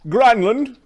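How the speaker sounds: loud, high call across the yard. Acted. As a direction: call the servant over. Master calls servant.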